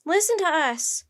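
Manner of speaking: high, loud demand; loudest yell. A narrator's reading. Jack demands the crowd's attention, yelling loudly. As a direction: yell to be heard by all. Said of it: "Jack yelled in his loudest voice".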